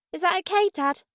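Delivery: high, lightly voiced child; child tentatively asking approval, pitched high; little girl. A narrator's reading. Daughter asks her father's permission, high and tentative.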